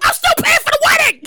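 hammed-up furious refusal; outraged shout. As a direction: yell it back like a broke spouse. Outraged reply to divorce request.

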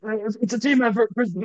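squeezed-out, high, voiceless coach impression; Doc Rivers, lost voice. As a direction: mimic a coach who has lost his voice. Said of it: "He talks like he lost his voice".

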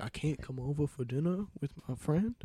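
soft, under-the-breath mock innocence; hushed retort. Guest answering the angry host, hushed retort. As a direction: answer back quietly, playing innocent.

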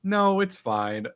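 embarrassed caller ending the call; awkward brush-off. Retold or imitated. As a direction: wave it off, mortified. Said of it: "And I was like".

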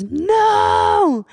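very high, long drawn-out refusal; high sustained mock wail; imitated refusal. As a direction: imitate a possessed girl wailing in refusal. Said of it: "She goes".

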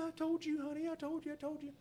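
very high whiny I-told-you; wife telling husband she was right. Retold or imitated. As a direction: nag like a smug wife. Wife voiced.

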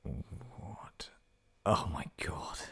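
hushed, voiceless disbelief; barely audible aghast reaction; stunned whisper. Real, not acted. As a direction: whisper it to yourself in shock. Taken aback by next joke.

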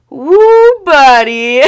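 cackling triumph; whooping with glee, laughing; gleeful whoop. Real, not acted. Gleeful about group chat exposure.

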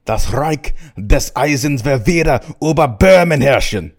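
high, loud German oratory; ranting Hitler. Acted. Hitler answering the press on Czechoslovakia, ranting in German. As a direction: declaim it as a forceful Teutonic speech. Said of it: "And Hitler replies".

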